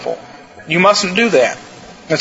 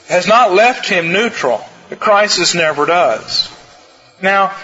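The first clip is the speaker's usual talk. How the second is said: ordinary delivery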